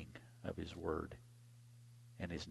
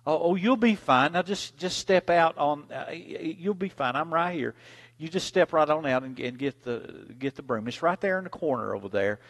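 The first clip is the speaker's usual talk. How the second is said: reassuring mama; 6 semitones higher, 5 dB louder than usual. Retold or imitated.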